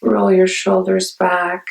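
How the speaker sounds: unhurried meditation guide with long pauses; guided meditation. Acted. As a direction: ease listeners into calm, phrase by phrase.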